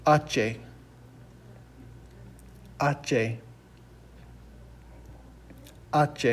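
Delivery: H said AH-chay; Spanish letter name. Shown as a demonstration. Teacher models the Spanish letter for students, clear demonstration. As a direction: say H as "hache" with silent h, AH-chay. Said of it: "we pronounce it in Spanish".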